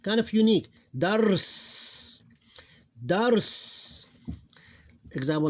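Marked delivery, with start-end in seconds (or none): 1.0-2.2 "Dars" as one drawn-out syllable ending on r-s; higher-pitched, held "Dars" with a long s; closed double-consonant ending. Shown as a demonstration. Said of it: "followed by two sukuns". 3.0-4.1 "Dars" as one drawn-out syllable ending on r-s; higher-pitched, held "Dars" with a long s; closed double-consonant ending. Shown as a demonstration. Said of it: "followed by two sukuns".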